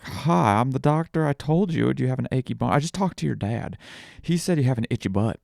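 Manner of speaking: high, loud, silly doctor voice; playful pretend doctor. Dad back as the doctor teasing his daughter, high and silly. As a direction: play a goofy make-believe doctor for a child.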